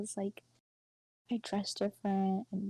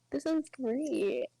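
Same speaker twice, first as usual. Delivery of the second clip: drawn-out, louder and higher mock delight; slow, sarcastic fake enthusiasm; 5 semitones higher, 6 dB louder, much slower than usual. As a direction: stretch it out with heavy irony. Sarcastic comment on being picked on.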